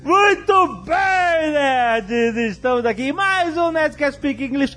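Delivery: high, drawn-out shout to the audience; excited shout. Real, not acted. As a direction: call it out like a show host. Show host calling out greeting.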